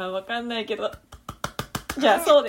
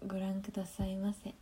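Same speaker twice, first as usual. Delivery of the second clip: whispered; 2 semitones lower, 9 dB quieter than usual. Pretend. Echoing a polite sign-off.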